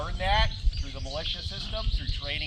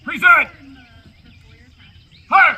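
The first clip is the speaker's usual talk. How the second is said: shouted command; 7 semitones higher, 8 dB louder than usual. Acted. Drill commands to firing line.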